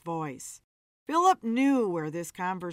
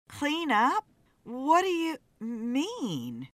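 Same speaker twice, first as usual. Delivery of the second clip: higher, drawn-out questioning; probing question; 5 semitones higher, slower than usual. A narrator's reading. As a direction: press him slowly for what he means. Wife questions husband's sudden promise.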